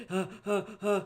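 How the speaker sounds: flat nervous huh huh huh; anxious Tina Belcher groans. Retold or imitated. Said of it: "I'm like Tina Belcher in the background".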